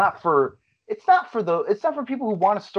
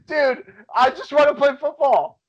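mock gamer shout; 9 semitones higher, 5 dB louder than usual. Mocking the football gamer.

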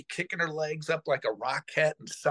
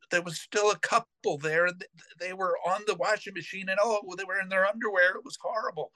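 scandalized retelling; 7 semitones higher than usual. Retold or imitated. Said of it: "She goes".

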